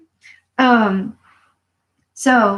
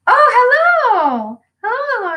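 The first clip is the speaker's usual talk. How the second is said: mock prissy voice; 5 dB louder than usual. Speaker mocking excuse-makers to viewers, high mock voice. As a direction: ham up a silly excuse-maker.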